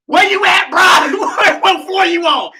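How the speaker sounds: high, loud call through the pipes; booming protector-mode holler; protective shout. Retold or imitated. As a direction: yell out to a stranger in trouble. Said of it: "this is exactly what I did".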